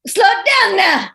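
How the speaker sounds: high loud goofy yell out a car window; silly yelling. Retold or imitated. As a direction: shout it out the window for laughs. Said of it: "yelling out the window".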